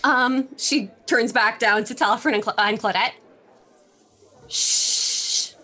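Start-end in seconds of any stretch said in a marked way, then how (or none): 4.5-5.5 shushing. Acted. Hushing others at the table.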